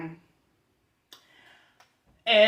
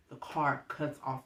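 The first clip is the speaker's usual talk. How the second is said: soft, low-key delivery; hushed, understated storytelling; subdued reveal; 3 semitones lower, 10 dB quieter than usual. Real, not acted. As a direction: drop the volume on the bad news. First take, subdued.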